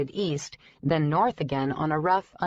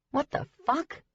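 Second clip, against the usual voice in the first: 1 shocked swear; 10 semitones higher, 3 dB quieter than usual. Acted. Bike restarts on its own.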